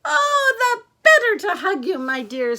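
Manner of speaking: loud falsetto, sliding down; wolf posing as Granny. Acted. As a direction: open very high in fake-granny sweetness. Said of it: "said the wolf".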